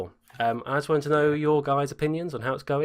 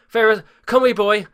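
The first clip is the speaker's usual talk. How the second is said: getting excited; 8 semitones higher, 7 dB louder, faster than usual. Real, not acted. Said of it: "I'm getting too excited".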